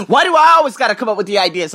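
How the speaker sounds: high, fast, whiny imitation of her; mock whiny friend. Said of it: "She's like".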